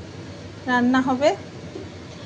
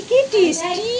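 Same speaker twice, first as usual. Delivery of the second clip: delighted; 7 semitones higher, 4 dB louder than usual. Real, not acted. Tasting treat with pet.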